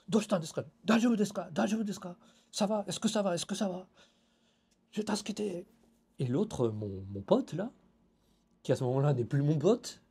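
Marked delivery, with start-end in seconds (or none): 0.1-5.7 lightly voiced neighbors asking, higher; a hint of alarmed neighbors; worried neighbors. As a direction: neighbors rushing out asking if he is okay, concerned.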